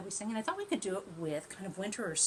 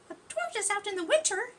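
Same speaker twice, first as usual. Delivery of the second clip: much higher, fast, chirpy bird voice; cheeping bird; 13 semitones higher, 6 dB louder, faster than usual. Acted. Bird mocking the tortoise, high fast cheep. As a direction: cheep it out like a scornful little bird. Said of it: "cheeped the bird".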